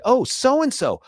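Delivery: high-pitched imitation of a kid; excited kid. Father retelling what the kids say, high kid voice. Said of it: "when the kids say".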